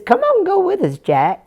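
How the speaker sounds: eager high call to a friend; boys calling Jack. Acted. Brothers urging Jack to come along, high eager call. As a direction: lift it up bright, inviting him along. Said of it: "Jack says".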